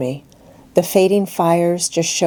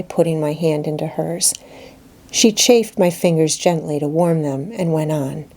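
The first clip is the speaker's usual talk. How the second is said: plain reading voice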